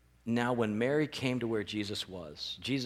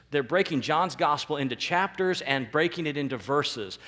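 ordinary talk

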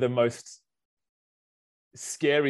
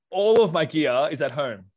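ordinary talk, nothing marked

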